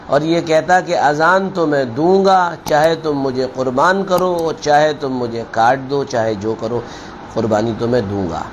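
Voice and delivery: ordinary talk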